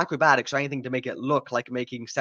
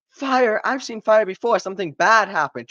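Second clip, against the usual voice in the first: higher, louder mock terror; hammed-up panic; 8 semitones higher, 5 dB louder than usual. Reviewer mocking the mechanic seeing fire, hammed-up panic. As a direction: overplay the trauma flashback. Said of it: "PTSD shock moment".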